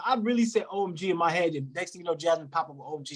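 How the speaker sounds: usual speaking voice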